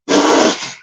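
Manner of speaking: sputtering lip-blown raspberry noise; imitated explosive splatter. Storyteller imitating the explosion from the wrestler's trunks, sputtering burst. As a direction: blow out a rude burst of noise. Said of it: "next thing I know is like".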